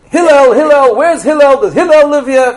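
mock yelling, high and insistent; acted scream. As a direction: holler for someone at his house, demandingly. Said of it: "he comes to his house and he screams".

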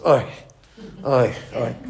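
low, soft whiny oi oi oi; kvetching oi. Shown as a demonstration. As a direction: moan like a mild complainer. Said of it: "Some people just kvetch".

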